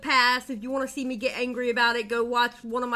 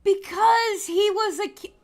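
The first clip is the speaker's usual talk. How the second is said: sudden higher, louder outburst; angry outburst cut short; flaring anger; 10 semitones higher, 5 dB louder than usual. Real, not acted. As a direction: snap before catching yourself. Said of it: "I'm not getting angry over this again".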